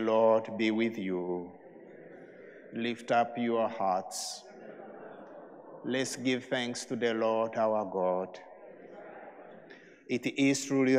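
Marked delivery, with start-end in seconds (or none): none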